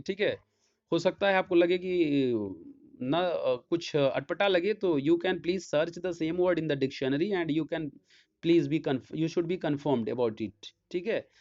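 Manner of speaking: normal talking voice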